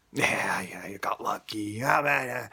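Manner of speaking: dismissive, voiceless mock drone; mocking doubter. Speaker mimics people calling the answered prayer coincidence, mockingly dismissive. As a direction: imitate a scoffer brushing it off as luck. Mocking those who call it luck.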